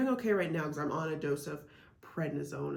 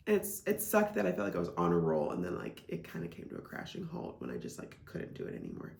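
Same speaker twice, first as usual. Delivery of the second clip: tearful; 12 semitones lower than usual. Real, not acted. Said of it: "Ugh, I'm feeling emotional".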